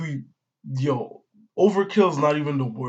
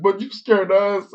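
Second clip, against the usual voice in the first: loud, raised-pitch imitated comeback; mock indignant retort; 7 semitones higher, 7 dB louder than usual. Nami answering a scared Brook, mock indignant retort. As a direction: throw the blame back, playfully indignant.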